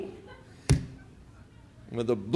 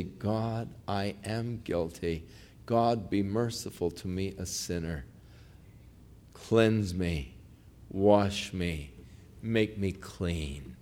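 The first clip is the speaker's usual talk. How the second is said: low, soft, slow pleading prayer; penitent prayer; 7 semitones lower, 10 dB quieter, slower than usual. Acted. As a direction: pray humbly and quietly, pausing between petitions. Models a sinner's prayer.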